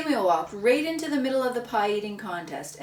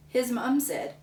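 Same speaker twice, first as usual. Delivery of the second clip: ordinary delivery, nothing marked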